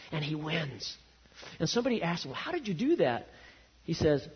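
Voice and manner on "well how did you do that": high-pitched astonished questioner; amazed question. Acted. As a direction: ask it in wonder, voice pitched up. Voices someone amazed at the winner.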